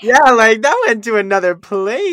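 loud, high and thrilled; adrenaline rush. Real, not acted. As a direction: burst out with excitement. Excited reaction to the deep remark.

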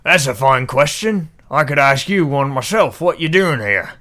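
put-on guard sneers back in a posh accent; mirrored posh accent. Guard questions stranger in a loud posh accent. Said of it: "I'm mirroring your accent now".

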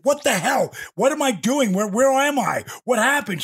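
high-pitched retold confusion, questions tumbling out; bewildered alarm. Wrestler asking his wife after a blackout, bewildered. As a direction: play a man coming to with no idea where he is.

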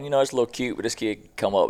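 ordinary delivery

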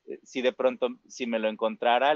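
ordinary delivery

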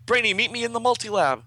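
high, loud comic-book order; urgent call. Acted. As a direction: call across to a teammate. Acted urgent call in story.

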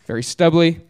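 ordinary talk

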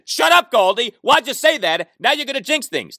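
high, scolding voice at himself; mock self-scolding. Scolds himself for mentioning no injuries, mock scolding. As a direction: tell yourself off for tempting fate.

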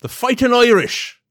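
high, loud leprechaun cry. Pretend. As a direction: shout it like a cartoon leprechaun. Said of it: "the dancing, like, uh, leprechaun thing".

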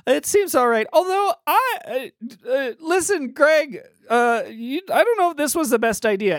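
high, hesitant little voice; squeaky fairy, unsure of the plan; tiny fairy. Acted. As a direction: explain awkwardly in a small high voice. Plays the fairy.

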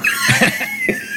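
high breathy laugh burst; a high airy laugh at the joke; burst of laughter. Real, not acted. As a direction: let a sudden laugh out.

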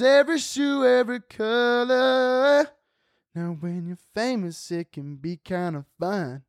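high, slow showy celebrity voice; pampered famous person bragging; rich celebrity. Pretend. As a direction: drawl it out like a flashy star. Put-on rich celebrity bit.

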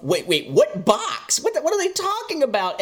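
baffled, rising to a high squeak; confused what box. Retold or imitated. Reviewer voices his own confusion at the mystery box in a baffled squeak. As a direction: stumble in disbelief over the box. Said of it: "I'm a combination of".

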